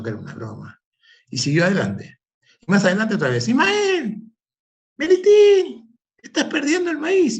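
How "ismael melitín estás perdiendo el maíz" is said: llamada muy aguda y alargada; grito lejano. Acted. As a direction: grita el nombre desde lejos, estirándolo. Vecinos gritan otra vez. (vecinos avisándole a gritos, muy agudo y alargado)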